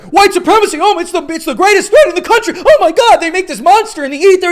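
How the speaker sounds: mocking the left. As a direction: parody a panicked activist, shrill and over the top. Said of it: "they're like".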